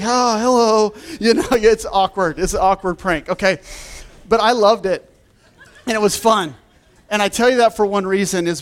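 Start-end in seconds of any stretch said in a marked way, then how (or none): 0.0-0.9 high, sheepish hello; awkward hello. Retold or imitated. Meeting tourists he fooled, a high sheepish hello. 4.3-6.6 laughing through the words. Real, not acted. Preacher laughing to the congregation about his prank.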